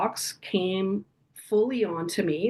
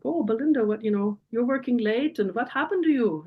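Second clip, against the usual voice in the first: imitated German-accented father, pitched high; 5 semitones higher than usual. Father questioning daughter working late, in a German accent. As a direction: do Dad's higher-voiced German accent. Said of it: "his wonderful German accent".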